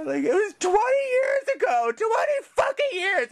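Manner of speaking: high, wailing protest through tears; crying. Real, not acted. Man slandered over something twenty years ago, crying. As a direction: sob it out, hurt and indignant. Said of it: "fucking crying right here".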